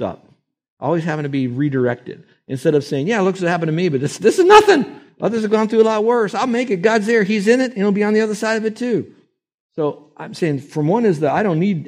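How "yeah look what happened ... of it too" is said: high, hopeful, lifted; upbeat, determined optimism; bright brave voice. Retold or imitated. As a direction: act out brave cheerful faith. Voicing the grateful sufferer.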